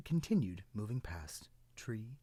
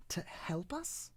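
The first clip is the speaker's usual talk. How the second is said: high, rising little cloud question; little cloud echoing; 10 semitones higher, slower than usual. Acted. Cloud echoes the tree's words, high puzzled question. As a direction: echo it back puzzled and high. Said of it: "said the little cloud".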